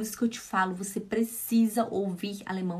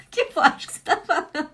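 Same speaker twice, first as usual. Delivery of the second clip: laughing question; 7 semitones higher, 6 dB louder than usual. Retold or imitated. Retelling Márcia's question, laughing.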